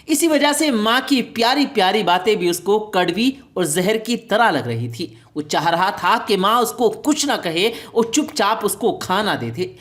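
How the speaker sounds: ordinary delivery, nothing marked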